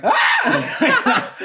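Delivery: very high laughing squeal; imitating Monica's shocked squeal; mock shocked shriek. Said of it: "Monica was like".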